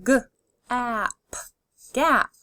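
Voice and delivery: d, ot, dot, parts pulled apart then joined; D, ot, dot: each part separate, then the whole word; segmented then blended. Shown as a demonstration. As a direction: sound it out and blend it. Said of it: "Keep saying 'em out loud".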